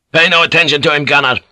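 snarled order. Acted. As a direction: snarl it at the gunner, overriding the priest. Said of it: "the Captain General snarled".

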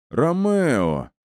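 high, drawn-out call of a name; mocking pirate hail, pitched high; pirate's sudden call. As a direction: break in suddenly from overhead. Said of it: "Внезапно раздался над моей головой голос пирата".

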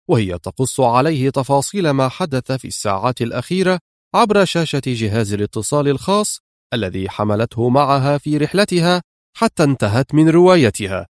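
ordinary delivery, nothing marked

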